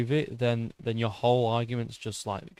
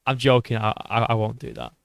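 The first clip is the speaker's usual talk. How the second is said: ordinary talk, nothing marked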